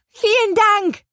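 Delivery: high, loud cry of thanks; relieved call. A narrator's reading. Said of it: "rief Petronella erleichtert". (erleichtert und laut gerufen)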